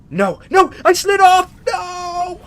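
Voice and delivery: high, loud panicked cries; frantic high-pitched yelling; panicked shrieking. Real, not acted. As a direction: shriek in real alarm at someone sinking. Slips while trying to reach NPC.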